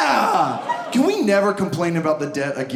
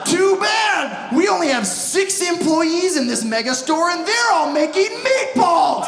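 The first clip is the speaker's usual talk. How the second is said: high, worked-up refusal; mock outraged store reply; 8 semitones higher than usual. Store telling a customer off, mock outraged. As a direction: build to a high-pitched holler.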